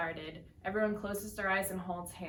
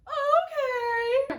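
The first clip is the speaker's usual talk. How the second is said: very high, loud falsetto okay; [name]'s hammed-up falsetto agreeing; mock woman's voice; 10 dB louder than usual. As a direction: squeak it like a man faking a woman. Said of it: "Do a woman's voice".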